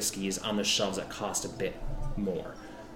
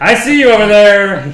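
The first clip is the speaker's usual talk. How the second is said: loud gruff barkeep; shark bartender voice; 10 semitones higher, 20 dB louder than usual. Acted. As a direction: bellow it across the bar. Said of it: "he says".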